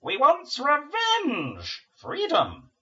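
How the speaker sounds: slow, loud, high buzzing command; buzzing demon voice. Acted. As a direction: drone the order like a swarm queen. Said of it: "buzzes the summoning grid's demonic inmate".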